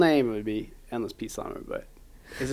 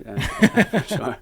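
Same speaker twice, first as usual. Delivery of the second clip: laughing; 7 semitones higher, 5 dB louder, much slower than usual. Real, not acted. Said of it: "laughing agreement".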